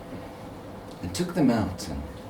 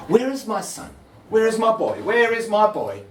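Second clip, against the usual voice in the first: father asking; 12 semitones higher, 5 dB louder than usual. Acted.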